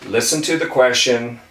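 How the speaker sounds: normal talking voice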